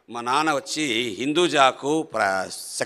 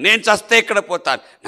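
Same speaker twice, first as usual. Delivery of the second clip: నేను చస్తే ఎక్కడ పోతాను cried out higher and louder, the word చస్తే hit hard; anguished outcry; 7 semitones higher, 6 dB louder than usual. Real, not acted. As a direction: burst out with the fear of dying. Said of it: "repeated louder". (ఆవేదనతో గట్టిగా అరవడం)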